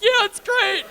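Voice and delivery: very high, loud, laughing through tears; hammed-up sob-laugh; laugh-crying. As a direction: squeal it out while laughing and crying. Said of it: "And I'm like".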